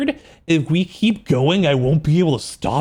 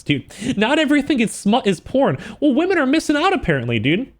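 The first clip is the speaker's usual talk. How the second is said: normal talking voice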